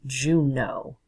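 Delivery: Juneau slowly as JUNE-oh; teaching pronunciation. Shown as a demonstration. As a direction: sound out "Juneau", ending in a clear O. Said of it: "is pronounced like O".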